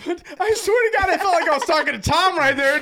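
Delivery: high-pitched, helpless laughter through the words; laughing. Real, not acted. Friend retelling his roommate's horse racing pitch, laughing helplessly. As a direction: barely get the story out for laughing.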